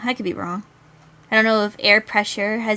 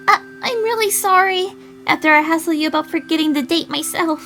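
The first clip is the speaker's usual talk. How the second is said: much higher, louder apology; apologetic girl; 8 semitones higher, 5 dB louder than usual. Acted. Girl apologising for forgetting the date, high apologetic voice. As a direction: apologise in a pitched-up character voice.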